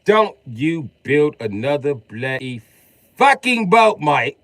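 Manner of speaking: mock-outraged Brit, rising to a high shout; hammed British shout. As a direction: bellow it in a mock British accent. Acted British scolding.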